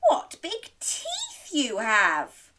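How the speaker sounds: slow, high, drawn-out awe; slow wonder. Acted. Little Red eyeing Granny's teeth, slow amazed voice. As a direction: stretch it out in mounting wonder.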